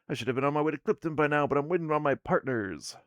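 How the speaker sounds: fast, loud, put-upon dwarf complaint; impatient dwarf woman. Acted. Dwarf woman complaining she's late, fast impatient grumble. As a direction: hurry it, she's late for Clipton.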